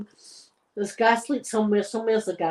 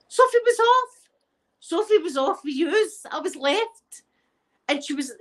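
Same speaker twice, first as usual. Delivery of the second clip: higher hammed-up complaint; pitched-up mock grievance; mock sulk; 7 semitones higher than usual. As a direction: whine it like a left-behind child. Mock sulk about being abandoned.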